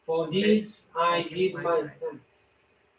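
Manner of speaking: prayer. Acted. Recites a prayer aloud.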